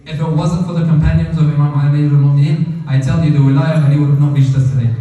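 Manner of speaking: loud, forceful sermon climax; fervent preacher's build; preaching. Acted. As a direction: drive the point home to the congregation.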